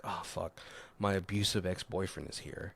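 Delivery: low, very soft retold aside; muttered dread. Woman spotting her ex at the party, muttered dread. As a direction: drop it to an uneasy mutter.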